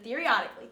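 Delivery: mock-slurred "Theoretically", syllables run together; imitating slurred speech. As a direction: lightly slur "Theoretically" as one mushy word. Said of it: "she started slurring her words".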